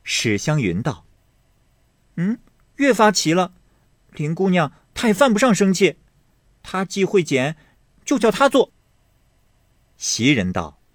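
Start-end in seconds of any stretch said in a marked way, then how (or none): 2.2-8.7 high, fast, rising scorn; young lady scoffing, pitch climbing; sharp retort. Acted. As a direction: scoff at the excuse.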